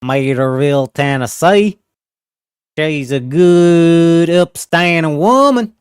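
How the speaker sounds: a lightly hammed-up Southern twang; Southern drawl. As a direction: lean into a Tennessee drawl. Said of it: "Everyone thinks I'm from the South".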